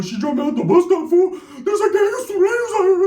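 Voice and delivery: high, crying, panicky plea; scared whine. Retold or imitated. Stranded primo begging his cousin for a ride, scared and whiny. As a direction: beg like you're stranded and scared. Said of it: "that tone of voice".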